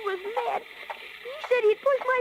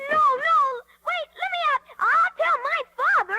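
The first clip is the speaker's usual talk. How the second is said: desperate pleading; 7 semitones higher than usual. Acted. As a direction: plead to be let out. Caged Pinocchio begs to leave.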